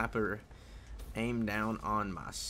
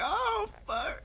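high squeaky "oh fuck"; surprised. Retold or imitated. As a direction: blurt it in a high panicked squeak. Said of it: "I'm like".

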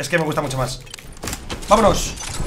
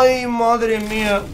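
drawn-out sigh; 4 dB quieter, much slower than usual. Real, not acted. Drawn-out exclamation.

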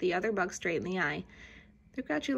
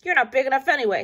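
fast loud dismissive scoff; grouchy ladybug; 5 semitones higher, 9 dB louder, much faster than usual. A narrator's reading. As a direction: brush it off quickly. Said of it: "said the grouchy ladybug".

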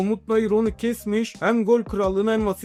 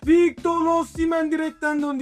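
excited commentator; 6 semitones higher than usual. Acted. Near miss off the post.

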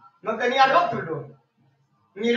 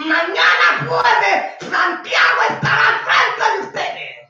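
rising preaching shout; 4 semitones higher than usual. Real, not acted. Preacher announcing next show to listeners, building shout. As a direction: proclaim tomorrow's program with force.

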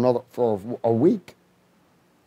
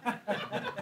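blissful sigh; 9 semitones higher, 15 dB quieter than usual. Retold or imitated. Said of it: "and I say".

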